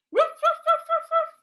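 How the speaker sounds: high, loud playful woofs through a laugh; barking dog. Acted. Storyteller barks as the dog trying to tell its owner, high and laughing. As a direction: bark like an excited little dog, laughing. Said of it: "he's trying to tell his owner".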